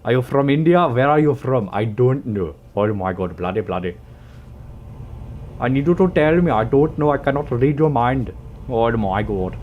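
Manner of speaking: loud, high hammed-up Indian accent; exasperated put-on Indian accent; mock Indian accent.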